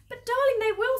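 high, loud distressed plea; hammed-up tearful protest; acted sobbing plea. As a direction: plead as an upset wife. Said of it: "sobbed Missus Fox".

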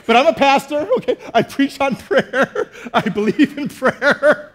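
high, loud, slow laughing confession; sheepish laugh. Real, not acted. Pastor laughing at his own hypocrisy to the church. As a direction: laugh at yourself, owning it. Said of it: "said through gritted teeth".

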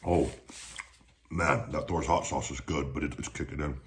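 talking while chewing; wiping mouth with napkin; mouth partly full. Real, not acted. Seen 1: napkin at mouth 0.1–0.9s, eating burrito, chewing before and during.

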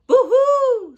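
very high, very loud hooting screech; owl screech. A narrator's reading. Owl spots the Gruffalo, loud high screech. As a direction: shriek it like a startled owl. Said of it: "screeched Owl".

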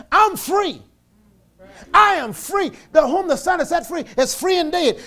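shouted, high declaration; proclaiming freedom. Pretend. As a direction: declare it like it's already true. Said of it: "hold to this right here".

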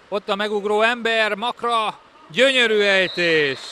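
loud, high goal call; rising thrill into the goal; excited sports commentary. Real, not acted. Live play-by-play, goal. (izgatott gólkiáltás)

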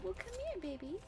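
high, soft coaxing call. Real, not acted. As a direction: coax the pet sweetly. Calling to a pet.

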